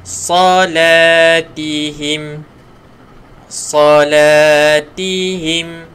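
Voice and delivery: Salatihim slow with a thick, full sa; teacher modelling a heavy sa. Shown as a demonstration. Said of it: "huruf sa tebalkan".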